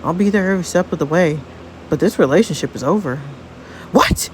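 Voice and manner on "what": shrill high gasp of disbelief; shocked what. Acted. Martha hears Frankie end it, shrill shocked cry. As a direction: shriek it in shock.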